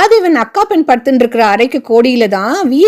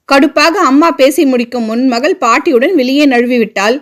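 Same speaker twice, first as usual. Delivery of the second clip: usual speaking voice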